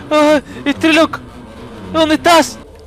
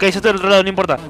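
ordinary delivery

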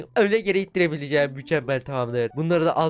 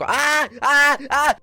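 sudden high shriek of fright; startled shriek; 12 semitones higher, 6 dB louder, much slower than usual. Real, not acted. Gamer shrieks at a sudden enemy. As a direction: yelp out in real alarm. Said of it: "Bu bağırışımı duymadınız".

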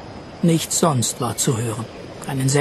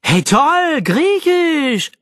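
very high, singing mock enthusiasm; 12 semitones higher, much slower than usual. A narrator's reading. Jack sneers at Fitzwilliam's Greek, mocking. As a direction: jeer in an exaggerated high voice. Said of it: "spottete Jack".

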